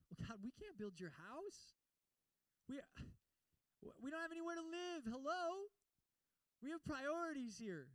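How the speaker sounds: mock whining Israelites; whiny excuse-maker. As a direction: sound put-out and defensive.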